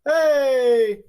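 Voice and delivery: loud happy yell; excited shout. Real, not acted. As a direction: cheer it out. Cheering audio fixed.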